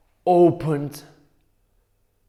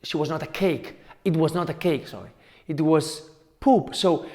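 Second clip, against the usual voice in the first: ordinary delivery, nothing marked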